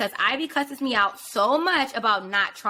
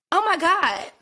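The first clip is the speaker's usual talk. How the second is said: imitating her date; 8 semitones higher, 3 dB louder, slower than usual. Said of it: "This is literally his words".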